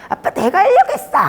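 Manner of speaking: high, loud acted child protest; child's yell. Child yelling at Dad for doing it first, acted shout. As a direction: yell it at Dad like an indignant kid. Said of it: "이렇게 소리를 지르죠".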